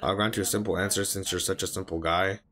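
low, subdued reading voice; subtitle read-aloud. A narrator's reading. Viewer reading anime dialogue to himself, subdued read-aloud.

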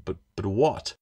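ordinary delivery, nothing marked

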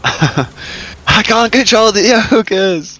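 high, loud, drawn-out wail; laughing protest. Real, not acted. Player protesting to impatient teammates, high drawn-out wail. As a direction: wail it slowly at high pitch.

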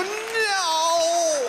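very high panicked no; squeaky cry. Acted. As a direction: cry out like a tiny creature. Toilet paper sheets protesting.

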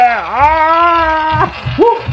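high sudden yelp of alarm; excited squeal. Real, not acted. Gamer yelping as his character nearly falls, high squeal. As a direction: yelp as the runner slips.

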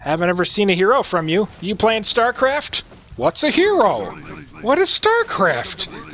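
high mock-innocent questioning; pretending not to know. Commentator voicing a player feigning ignorance, mock innocence. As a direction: ask it high, playing clueless. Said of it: "He's pretending like he doesn't know".

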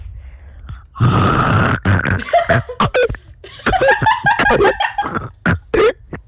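shrill drawn-out squealing laugh; high wild laughing scream. Real, not acted. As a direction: let out a long piercing laugh. Friend screams on request.

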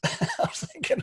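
breathless laugh through the words; laughing as he speaks. Real, not acted. Host laughing at guest's story, breathless laugh.

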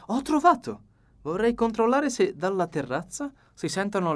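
the dapper detective, pleased with his plan; Poirot voice. A narrator's reading. Poirot explains plan to Flora.

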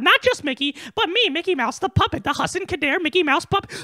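ordinary delivery

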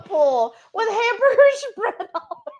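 talking through real laughter; helpless giggles breaking into the words; cracking up. Real, not acted. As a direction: barely get it out for laughing. Cracking up while reading comment.

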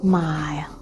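breathless whispered gasp of shock. Real, not acted. Host, stunned by the stool story, whispers in shock. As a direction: hush it out, stunned. Host reacts to near-fatal blow.

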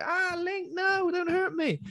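very high, slow mock whimper; scared villagers. Villagers begging Link not to hurt them, squeaky mock fear. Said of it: "they're like".

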